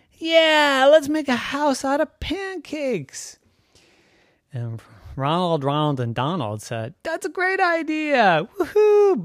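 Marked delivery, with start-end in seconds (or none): 0.1-3.4 put-on high-pitched, eager boy voice; excited kid. A narrator's reading. Boy pitching a pancake house to his friends, excited. 7.0-9.3 put-on high, gleeful kids' voices; excited cheer. A narrator's reading. Friends agreeing to build a pancake house, excited.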